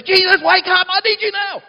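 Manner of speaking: high, loud, panicked cry to Jesus; screaming emergency. Retold or imitated. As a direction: beg frantically as if drowning. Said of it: "that screaming sense of emergency".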